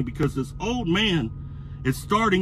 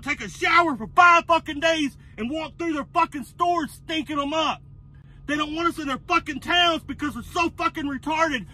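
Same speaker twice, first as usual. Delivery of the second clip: furious rant; 10 semitones higher than usual. Real, not acted. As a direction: let the anger boil over, much higher in pitch. Furious rant about arrogant drivers.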